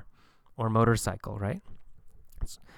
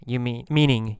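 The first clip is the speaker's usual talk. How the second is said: usual speaking voice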